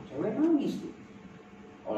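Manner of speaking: ordinary delivery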